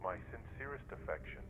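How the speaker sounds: quiet, breath-light letter voice; letter read aloud. A narrator's reading. Son confides in widow's family, hushed letter voice. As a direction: confide it softly, as if in writing.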